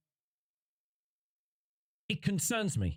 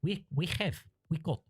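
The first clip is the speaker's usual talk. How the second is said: put-on a clumsy, half-hearted Swedish accent on corporate pushback; mock Swedish developer brushing off the bozo; bad Swedish accent; 3 semitones higher than usual. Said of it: "Dreadful Swedish accent".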